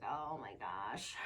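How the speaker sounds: low, slow, soft exasperation; exasperated. Retold or imitated. Mom exasperated by drop-off meltdown, low and slow. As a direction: sigh it out, worn down. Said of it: "and it's like".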